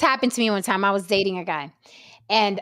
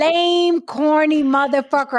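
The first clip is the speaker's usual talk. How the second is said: mock outrage; 3 semitones higher than usual. Woman dressing down the man she upgraded, mock outrage. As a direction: tear him down with hammed-up indignation.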